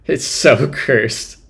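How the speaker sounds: breaking into laughter mid-sentence; slow words dissolving into a laugh. Real, not acted. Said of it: "I can't stop laughing at it".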